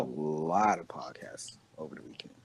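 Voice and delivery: soft whispered repeat. Real, not acted. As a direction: breathe the words as a private aside. Repeats his line whispered.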